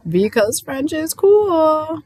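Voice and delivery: high, loud, drawn-out hype; playful exclaim. Acted. Teacher joking to students, slow excited exclaim. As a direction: sell it like a gleeful joke.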